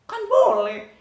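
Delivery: high, loud defiant squeal; a child defying the rules with a high shout. Acted. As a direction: throw it back like a cheeky kid. Child defiantly claims rights.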